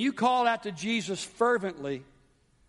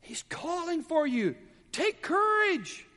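higher, mock-gentle crowd coaxing; lightly put-on sugary crowd voice; fake sweet crowd; 8 semitones higher than usual. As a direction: sweet-talk like a fickle crowd. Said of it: "They were saying".

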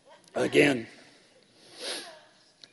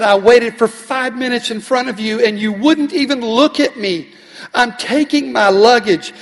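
louder, higher, firm reply; indignant retort; 8 semitones higher, 7 dB louder than usual. Retold or imitated. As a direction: tell her off for ignoring you. Pastor arguing with clerk.

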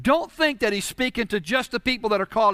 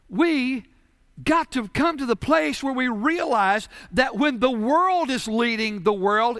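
usual speaking voice

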